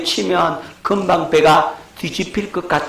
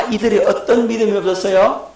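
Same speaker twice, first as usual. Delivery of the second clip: usual speaking voice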